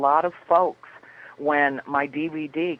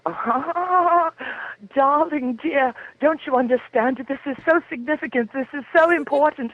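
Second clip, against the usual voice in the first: put-on much higher, pleading English accent, whiny and sweet; Devin's whiny English accent; 10 semitones higher than usual. Producer Devin begging her to keep the interview, high whiny English accent. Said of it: "whines in an English accent".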